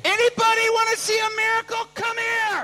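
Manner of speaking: high, loud proclamation; crowd-summoning shout. Retold or imitated. Son to hundreds of onlookers, high shout. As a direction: holler like a barker.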